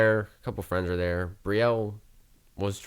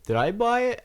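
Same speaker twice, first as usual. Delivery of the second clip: much higher puzzled question; confused drunk; 14 semitones higher, 4 dB louder than usual. Retold or imitated. Drunk friend asks about his own car, high confused drunk. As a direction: ask it like a lost drunk.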